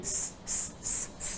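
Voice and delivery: long unvoiced hiss of s; hissing s sound. Shown as a demonstration. Teacher demonstrates the letter sin, hissing s. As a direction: sustain the s with the tongue tip. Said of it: "Jadi kalau sin".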